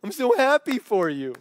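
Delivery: high, halting fake congratulations; forced happiness. Envious worker congratulates friend with a great job, forced happiness. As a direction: grudgingly act pleased for a friend. Feigned joy for luckier friend.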